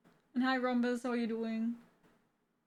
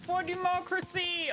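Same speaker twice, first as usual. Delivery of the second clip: Helldivers battle cry; 7 semitones higher than usual. Retold or imitated. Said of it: "They say it a lot in the game".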